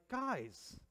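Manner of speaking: exasperated Jesus. Retold or imitated. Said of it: "He's like".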